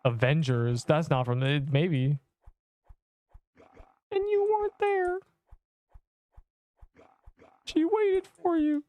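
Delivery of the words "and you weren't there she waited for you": mock Tony Stark. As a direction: drag out the line like a cartoon parody. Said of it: "When Tony Stark told Cap".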